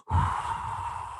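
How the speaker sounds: long blown-out whoosh of air; imitated freeze breath. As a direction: blow out hard like icy breath.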